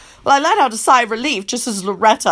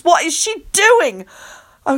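ordinary delivery

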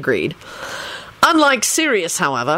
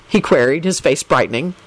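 usual speaking voice

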